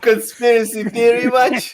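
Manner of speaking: high, mocking teasing shout. Real, not acted. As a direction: call out the conspiracy as a joke. Teasing friend over Texas.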